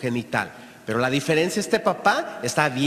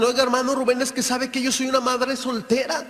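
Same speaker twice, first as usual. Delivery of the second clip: worried mother; 6 semitones higher than usual. Retold or imitated. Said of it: "muchas mamás preocupadas me dicen".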